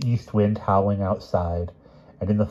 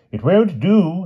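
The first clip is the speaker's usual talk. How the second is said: high, pained refusal; more sorrowful than angry; 12 semitones higher, 6 dB louder, slower than usual. A narrator's reading. Sir Palomides rejects the king's poem, more sorrow than anger. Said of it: "more in sorrow than in anger".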